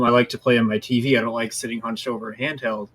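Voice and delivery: ordinary talk, nothing marked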